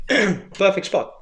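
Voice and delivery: normal talking voice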